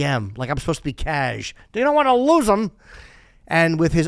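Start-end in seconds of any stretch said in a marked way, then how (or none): 1.7-2.7 higher, louder exaggerated slang delivery; mock casual voice. Mocking the ad copy's forced casualness, hammed-up. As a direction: ham up the casual phrasing. Casual version of scripted line.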